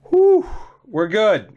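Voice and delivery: high, slow relieved exhale. Real, not acted. Gamer calming down after the goal, relieved. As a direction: breathe out the relief.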